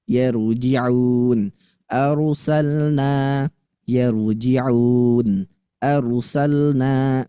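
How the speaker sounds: "yaruji'un" and "Arsalna" read very slowly with a clear forward ro; slow, careful teacher recitation; model ro. Shown as a demonstration. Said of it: "Nah, yaru".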